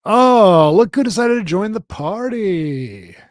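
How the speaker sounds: high, loud, drawn-out mock surprise; loud sing-song needling of a late arrival; mock-exclaiming welcome. Real, not acted. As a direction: greet the latecomer with a big teasing swoop. Missing co-host suddenly reappears.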